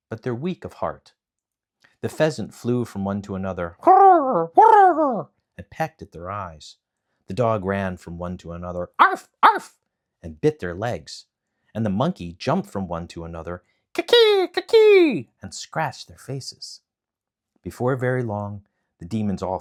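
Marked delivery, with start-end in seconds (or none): 3.8-5.3 very high, loud whirr; whirring wings. Acted. Pheasant dives at the demons, loud whirr. 9.0-9.8 very high, loud yapping bark; barking dog. Acted. Dog barks at the oar, high yap. 13.9-15.3 very high, loud screech; screeching monkey. Acted. Monkey screeches holding the ship, high shriek.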